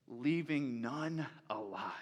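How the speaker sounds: slow, hushed whisper; slow breathy whisper. Acted. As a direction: let it land in a whisper. Climax of God's promise, read aloud.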